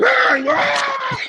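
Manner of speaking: sudden high shriek; mommy's scream. Retold or imitated. Mommy spooking the boss with the raccoon tail, high shriek. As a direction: shriek out in fright. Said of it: "mommy goes".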